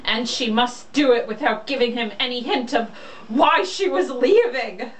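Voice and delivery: ordinary delivery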